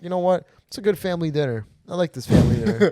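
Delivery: high-pitched sarcastic cheer; hammed-up sarcastic approval; mock delight. Sarcastic retort to murder accusation.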